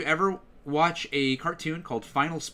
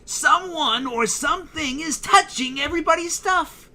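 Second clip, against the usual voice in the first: higher, louder guttural cartoon kid; Eddie impression; 6 dB louder than usual. Shown as a demonstration. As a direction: announce it like a dramatic scheming kid. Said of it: "He's all over the place with that voice".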